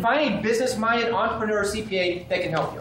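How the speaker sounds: normal talking voice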